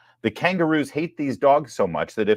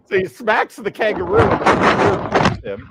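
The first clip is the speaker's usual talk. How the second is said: laughing; 10 semitones higher, 7 dB louder, slower than usual. Real, not acted. Describing clip while laughing.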